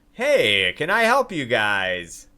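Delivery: lightly voiced blacksmith, loud drawn-out hail; blacksmith greeting. Blacksmith greeting adventurers at her counter, loud lightly voiced hail. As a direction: call out a slow greeting to customers. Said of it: "She says".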